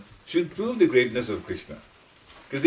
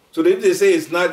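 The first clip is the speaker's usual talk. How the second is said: normal talking voice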